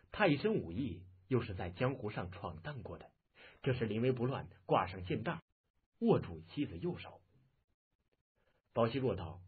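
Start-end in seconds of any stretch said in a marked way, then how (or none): none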